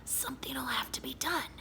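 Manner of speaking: high, soft scratchy whisper. Acted. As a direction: rasp it out in a thin whisper. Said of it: "said Miss Nelson".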